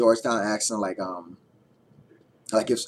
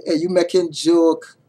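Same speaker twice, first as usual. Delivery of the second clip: higher, louder, slow Georgetown Guyanese "you making joke?"; Georgetown accent, disbelieving; 8 semitones higher, 9 dB louder, slower than usual. Shown as a demonstration. Showing viewers a Guyanese saying, incredulous Georgetown accent. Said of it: "another one would be".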